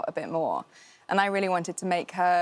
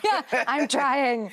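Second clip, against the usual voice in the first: drawn-out, higher-pitched laughing protest; mock protest; 6 semitones higher, much slower than usual. Actress retelling failed audition to hosts, mock protest. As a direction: plead your effort, playfully stretched out.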